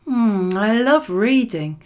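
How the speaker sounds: ordinary talk, nothing marked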